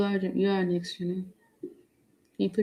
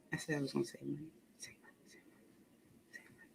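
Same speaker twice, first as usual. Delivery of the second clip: soft unvoiced whisper to herself; 3 semitones lower, 19 dB quieter, much faster than usual. Real, not acted. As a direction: whisper it under your breath.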